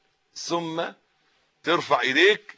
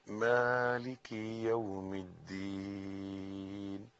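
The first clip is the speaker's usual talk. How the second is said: Quran recitation; 6 semitones lower, 13 dB quieter than usual. Shown as a demonstration. Teacher opening the lesson by reciting softly, quiet measured chant. Recites Fatiha slowly as demo.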